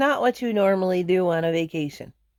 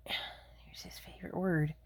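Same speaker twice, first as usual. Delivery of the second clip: whisper; 2 semitones lower, 16 dB quieter than usual. Real, not acted. Aside about the cat.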